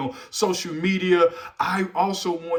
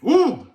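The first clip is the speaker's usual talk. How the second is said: excited ooh; 8 semitones higher, 8 dB louder than usual. Real, not acted. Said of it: "[name], you, you coming with the smackable".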